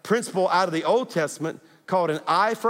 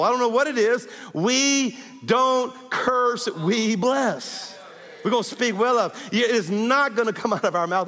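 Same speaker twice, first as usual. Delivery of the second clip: preaching at full force; 4 semitones higher than usual. Real, not acted. Pastor urging the church to bless instead of curse, preaching hard. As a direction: press it home to the congregation.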